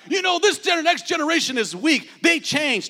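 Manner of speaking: high, exaggerated complaint; mock grumbling elder. Pastor mocking parents who call the next generation weak, high and scoffing. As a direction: scoff at the kids like a cranky elder.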